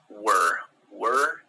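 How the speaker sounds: "were" said as wer, r-coloured vowel, not where or war. Shown as a demonstration. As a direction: say "were" with a short er sound. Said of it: "Kind of neither of those".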